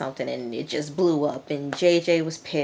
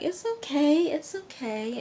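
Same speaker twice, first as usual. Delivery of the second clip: reassuring; 12 semitones higher than usual. Retold or imitated. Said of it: "Alyssa was like".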